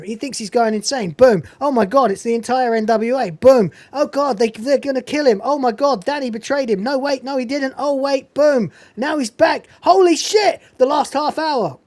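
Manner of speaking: rising hype, pitch climbing; excited. Real, not acted. Escalating hyped recap.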